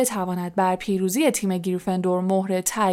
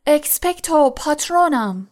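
higher-pitched, understated roar; shouted spell; 6 semitones higher, 3 dB louder than usual. A narrator's reading. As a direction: cry out the incantation, raised pitch. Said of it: "نعره زد".

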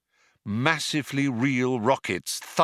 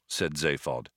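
plain reading voice

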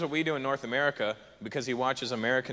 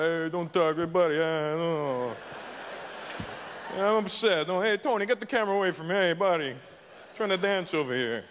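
upset Italians. Retold or imitated. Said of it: "They were like".

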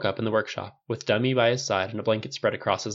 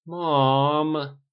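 higher, louder whine of protest; 5 semitones higher, 5 dB louder than usual. A narrator's reading. Thirteen-year-old squirming in his mother's arms, protesting. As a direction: drag it out like an embarrassed kid. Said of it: "Tony protested, squirming".

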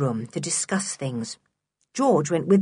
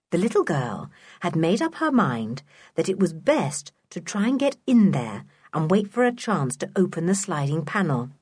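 ordinary delivery, nothing marked

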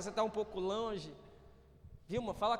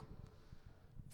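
whispered aside; 21 dB quieter than usual. Real, not acted.

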